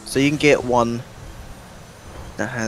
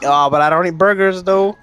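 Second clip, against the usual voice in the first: loud, high mock protest; high-pitched, exaggerated teasing quote; mocking imitation; 9 semitones higher, 7 dB louder than usual. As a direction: mimic your friend in a raised, teasing voice. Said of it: "you're over here like".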